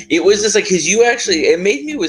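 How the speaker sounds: high, loud, worked-up gush; excited. Real, not acted. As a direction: gush about how it moved you. Moved by the scene.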